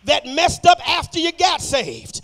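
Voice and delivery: loud, driving church exhortation; full-force preaching. Real, not acted. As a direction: shout it out to the congregation, stretching the big phrases.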